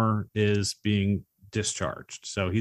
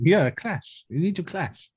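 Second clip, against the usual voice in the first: high, swooping designer imitation; interior designer; 8 semitones higher than usual. Designer advising soldiers on camouflage, high imitated designer voice. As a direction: imitate a designer insisting, pitched well up. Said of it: "doing with the interior designer".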